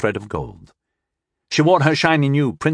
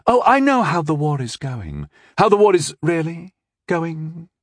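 princess voice; 7 semitones higher than usual. Acted.

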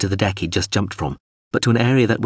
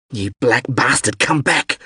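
angry call, rising higher; restrained bellow, climbing at the end; acted bellow; 3 semitones higher than usual. A narrator's reading. Abandoned crewman orders pilot back, mildly acted bellow. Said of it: "Lamb bellowed".

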